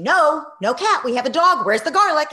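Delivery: loud, high, curt brush-off; impatient refusal. Retold or imitated. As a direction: wave her off without listening. Distracted mother refusing again.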